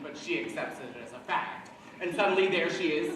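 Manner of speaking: normal talking voice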